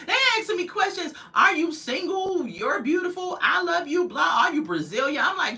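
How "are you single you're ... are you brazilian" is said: mocking commenters. Mimicking troll commenters.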